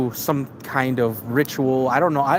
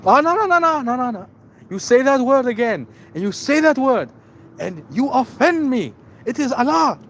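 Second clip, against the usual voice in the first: high, loud indignant outburst; mock offended yelling; 10 semitones higher, 4 dB louder than usual. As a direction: play the offended diplomat, shrill and loud. Offended diplomat voiced.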